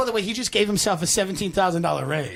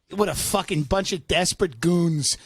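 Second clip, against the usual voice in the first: ordinary delivery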